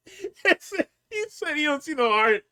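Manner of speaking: high voice through real laughter; laughing hard. Real, not acted. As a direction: repeat it while cracking up. Cracking up retelling the insult.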